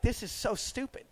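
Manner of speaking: hushed, dismayed aside to oneself. Retold or imitated. Traveler privately reacting to another red flag, hushed dismay. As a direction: say it under your breath as misgivings grow.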